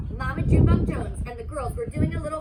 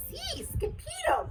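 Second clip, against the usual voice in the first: much higher, slow panting squeak; 13 semitones higher, 4 dB quieter than usual. Acted. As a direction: gasp it out high like the tiniest dog. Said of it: "panted Poquito Tito".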